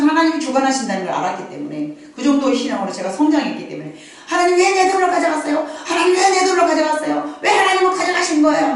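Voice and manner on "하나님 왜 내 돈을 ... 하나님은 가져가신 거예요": high, loud whiny complaint to God; complaining to God. Retold or imitated. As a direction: wail the grievance up at God. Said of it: "막 이렇게 불평을 했더니". (하나님께 높은 목소리로 투덜대며 따지듯)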